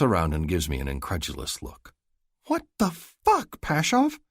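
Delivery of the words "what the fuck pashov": much higher, louder, slow and drawn out; startled outraged shout. Acted. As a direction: yelp after being grabbed. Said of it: "emits a shriek so loud".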